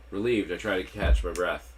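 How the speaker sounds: usual speaking voice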